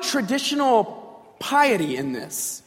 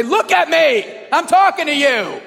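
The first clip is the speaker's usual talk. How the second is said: put-on loud, high scolding of a child; parental yell; 5 semitones higher, 5 dB louder than usual. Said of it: "ever yell at your kids like that".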